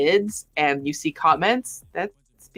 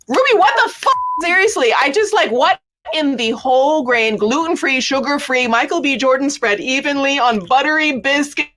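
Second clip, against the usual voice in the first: outraged disbelief; 8 semitones higher, 4 dB louder than usual. Real, not acted. Outraged rant at Ruby.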